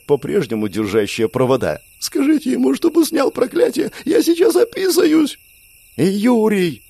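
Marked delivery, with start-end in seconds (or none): 2.0-5.4 panicked, very high, near tears; tearful scream. A narrator's reading. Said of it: "Надрывно со слезами орала". 6.0-6.8 high, ringing cry; young Claire calls out to Yuri, ringing. A narrator's reading. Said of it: "звонко воскликнула Клэр".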